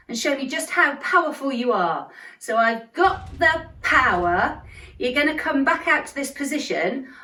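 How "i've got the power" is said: slow, got and power punched out; stress on got and power. Shown as a demonstration. Teacher showing the kids, slow stress on got and power. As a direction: hammer got and power, slowly. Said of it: "The emphasis is on got and power again".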